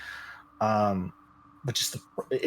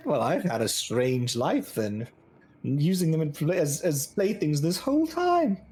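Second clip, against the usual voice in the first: amused scoff, rising much higher as it goes; mocking god; 5 semitones higher than usual. A god joking with fellow gods, mocking. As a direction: voice climbing in mock wonder. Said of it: "laughing with each other".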